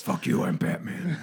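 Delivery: mock-tough vigilante one-liner; Batman. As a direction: toss off a hint of a masked hero. Second speaker joins the Batman impression.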